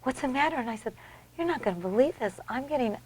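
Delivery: soft, hushed replay of being overcome; retold tearful disbelief. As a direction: recount tearful news softly, faltering. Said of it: "I'm standing there crying".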